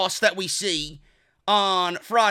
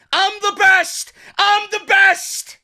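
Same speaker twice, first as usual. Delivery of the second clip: high, loud, drawn-out boasting shout; slow, full-voiced imitation yell, pitched way up; Santana and Ortiz yell; 11 semitones higher, 7 dB louder, slower than usual. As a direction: imitate a wrestler yelling a catchphrase. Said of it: "how Santana and Ortiz yell".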